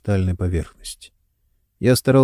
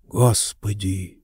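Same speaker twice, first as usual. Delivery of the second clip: surprised; 2 semitones lower, 5 dB quieter, slower than usual. A narrator's reading. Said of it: "удивлённо сказал я".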